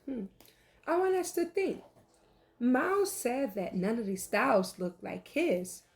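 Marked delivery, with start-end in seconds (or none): none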